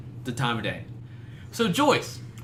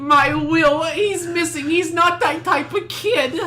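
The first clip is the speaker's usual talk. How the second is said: mock Joyce; 11 semitones higher, 4 dB louder, much slower than usual. Joyce mockery, missing son.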